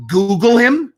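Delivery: high, loud disbelieving question; can't believe what he's hearing; incredulous. Retold or imitated. Retelling his friend's call, he asks in high, loud disbelief. Friend tells him to Google.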